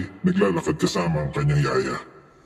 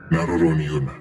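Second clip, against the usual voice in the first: plain reading voice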